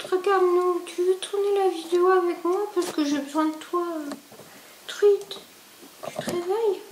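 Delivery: high cutesy pet-talk voice; little voice for the cat. Acted. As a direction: coo at the cat in a tiny high voice. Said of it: "quand je prends une petite voix".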